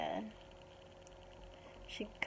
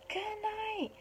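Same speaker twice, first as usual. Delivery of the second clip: gorilla calling goodnight; 12 semitones higher, 4 dB quieter than usual. A narrator's reading. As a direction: call it out softly, way up high. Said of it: "The gorilla shouts".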